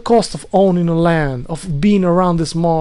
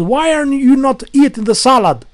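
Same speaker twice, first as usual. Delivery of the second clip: mock yell, a bit higher and louder; imitated scream; 7 semitones higher, 4 dB louder than usual. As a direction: retell his shouting with a touch of mock anger. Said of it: "screamed at my ear here".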